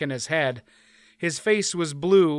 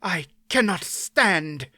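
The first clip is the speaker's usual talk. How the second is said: through clenched teeth; 3 semitones higher, slower than usual. A narrator's reading. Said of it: "from between clenched teeth".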